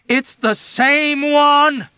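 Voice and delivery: high loud, very slow emphatic shout. A narrator's reading. Dunbar shouting above the noise to Hungry Joe. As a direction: shout it over the commotion. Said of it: "Dunbar shouted at him emphatically".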